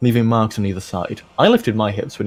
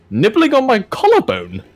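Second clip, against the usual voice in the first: squeaky high; 11 semitones higher, 4 dB louder than usual. Real, not acted. Laughing at the smut.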